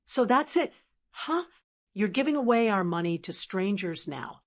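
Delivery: usual speaking voice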